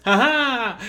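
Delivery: bursting into high laughter; laughing. Real, not acted. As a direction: laugh out loud at the joke. Reading a joke, laughing.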